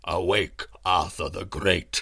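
low, slow, resonant summons; lightly voiced deep herald calling; great voice. A narrator's reading. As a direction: call the king awake with a deep voice. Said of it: "said the voice again".

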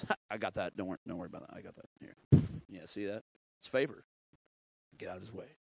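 low soft unbothered reassurance; calm Holy Spirit voice. Retold or imitated. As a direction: reassure like it's nothing to you. Voicing Holy Spirit, calm confident.

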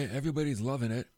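ordinary delivery

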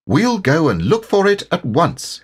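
high, urgent call; keen rallying cry, not a full shout. A narrator's reading. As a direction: call out eagerly, pitched well up. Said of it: "he called out to Eeyore".

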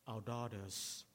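ordinary talk

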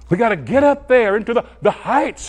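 mock striving, pitched high and loud; high, loud parody of straining upward; striving believer. Preacher mimicking believers reaching for the heights, mock striving.

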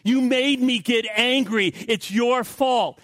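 high, drawn-out accusing whine; mock-indignant, high-pitched blaming; blame-shifting. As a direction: pin it all on your wife, slowly. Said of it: "he doesn't want to hear Frank say".

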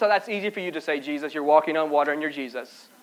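low, very soft grumble; muttered retort. Acted. Preacher answers Jesus back as a doubter, muttering low. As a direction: grumble it under your breath.

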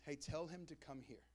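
soft, low and tender invitation; gentle call. Retold or imitated. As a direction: invite him kindly, almost hushed. Jesus calls the blind man.

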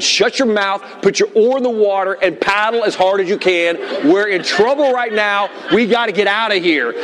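high, driving orders to a son; re-enacted dad hollering over a storm; retold urgent yell. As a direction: bark out commands in mock panic. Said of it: "I just screamed".

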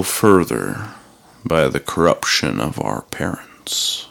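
firm and slow, stating plain fact; calm, resolute old man; resolute, matter-of-fact. A narrator's reading. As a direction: lay it down as settled truth. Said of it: "spoke in a resolute, matter-of-fact tone".

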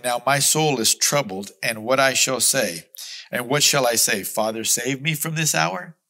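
loud, ringing scripture proclamation; booming pulpit reading; preaching at full force. Acted. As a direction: proclaim the verse to the whole church.

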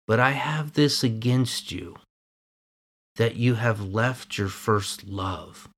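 steady, earnest Bible reading; scripture reading. Acted. Preacher reading Revelation chapter two to the church, earnest reading.

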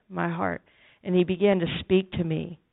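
ordinary talk

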